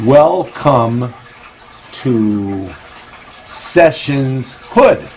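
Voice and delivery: slow, word by word with long gaps; halting read-out. Real, not acted. Host deciphering backwards writing aloud, slow and halting. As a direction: decipher it slowly, pausing between words.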